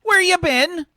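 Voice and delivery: high, loud, slow mock-stern mum; scolding mum. Mum scolding Arthur for being away, high and loud. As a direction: size him up like a nagging mother. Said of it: "she says".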